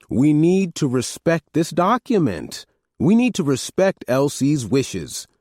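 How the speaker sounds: slow and firm, NEED stressed; insisting. A narrator's reading. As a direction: lean hard on each word. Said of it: "Harris insisted".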